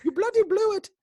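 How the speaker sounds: very high, loud cartoon Brit; hammed-up English scolding; mock English accent. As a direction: shriek it like a silly posh Englishman. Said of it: "anytime I do any accent".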